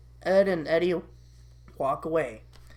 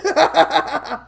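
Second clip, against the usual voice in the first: loud, high villainous laugh; mocking devil cackle; 9 semitones higher, 7 dB louder than usual. Devil laughing at Eddie, loud mocking cackle. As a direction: cackle in acted scorn.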